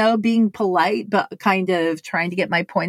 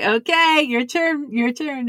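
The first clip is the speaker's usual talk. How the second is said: high, cheeky your turn; high teasing sing-song; gleeful handoff; 7 semitones higher than usual. Retold or imitated. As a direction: pass it to him with glee. Handing mic to laughing friend.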